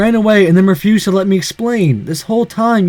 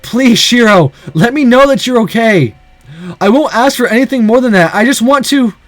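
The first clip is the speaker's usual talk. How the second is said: higher, urgent raised voice; desperate pleading; 4 semitones higher, 4 dB louder than usual. Acted. Boy fearing for Shiro's safety, desperate pleading. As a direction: beg to hear the friend is safe. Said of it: "I had grown desperate".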